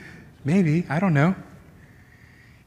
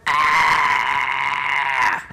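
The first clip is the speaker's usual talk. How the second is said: short mock toddler cry; toddler's panicked wail; 6 semitones lower than usual.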